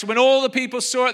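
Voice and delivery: ordinary talk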